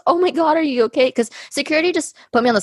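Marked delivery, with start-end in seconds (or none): 0.1-1.1 a kind stranger rushing to help, higher; worried girl. Retold or imitated. Ambassador girl finding her on the sidewalk, high worried voice. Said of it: "She goes".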